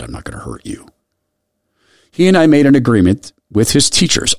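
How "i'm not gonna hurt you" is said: much softer and lower, near hush; soft reassurance. Retold or imitated. Teacher promising an abused boy safety, hushed. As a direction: murmur the promise tenderly.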